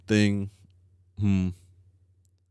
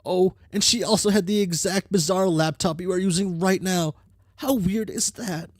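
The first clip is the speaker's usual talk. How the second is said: put-on bright, higher girl character read; troll girl character voice; 9 semitones higher than usual. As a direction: voice the troll with cocky energy. Reads game character lines.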